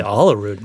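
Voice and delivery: "Olerud" opening with an AH, "AH-luh-rood"; own pronunciation of Olerud. Shown as a demonstration. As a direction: say "Olerud" with a broad first vowel, as in "all". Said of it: "I say Olerud".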